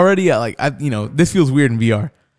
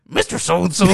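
put-on higher voice for a player; imitated voice effect; 5 semitones higher than usual. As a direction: mimic a voice-changer with your own voice. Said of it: "this effect on his voice".

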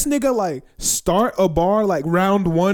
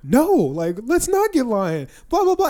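mocking classmates; 9 semitones higher than usual. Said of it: "And they're like".

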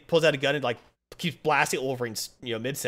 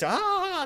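high drawn-out "ah"; mock scream. Attackers flying Wolverine off, high mock scream. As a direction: imitate being carried off screaming. Magic stars fly them off.

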